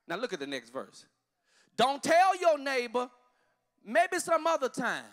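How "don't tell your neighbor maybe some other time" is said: loud, slow preacher call; preaching. Acted. Pastor to congregation, preaching at full force. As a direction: drive the point home from the pulpit.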